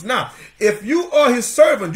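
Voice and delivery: normal talking voice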